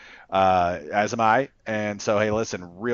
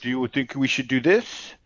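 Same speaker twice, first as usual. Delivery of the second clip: mock-hesitant, deferring question; wishy-washy parody of asking approval; mock indecision; 2 semitones higher than usual. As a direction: imitate a rep asking for permission. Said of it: "they don't want you to be like".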